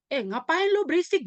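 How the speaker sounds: high-pitched, lightly acted yell of classmates; mock yell. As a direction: imitate students shouting at a noisy friend. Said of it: "Pada teriak mereka".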